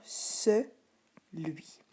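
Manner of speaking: "ce", then "lui", split by a pause; syllable by syllable. Shown as a demonstration. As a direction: slowly sound out "ce ... lui". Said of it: "tu pouvais lire".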